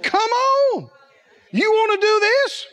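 very high, rousing shout; eager invitation. Acted. Jesus daring Peter to walk on water, shouting eagerly.

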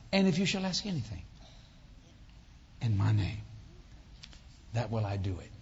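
low, measured scripture recitation; subdued, reverent quotation; quoting scripture. Real, not acted. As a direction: recite the verse low and steady. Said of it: "I quote the scripture".

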